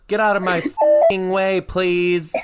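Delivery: put-on high, loud kid demand with a tacked-on please; rude kid with a polite tag. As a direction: bark it, then add please like a child. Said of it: "And he goes".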